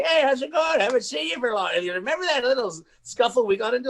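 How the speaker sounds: mock cheery high voice; casual old foe. Imitating the casual guy years later.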